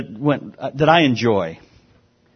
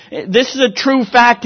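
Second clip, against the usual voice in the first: usual speaking voice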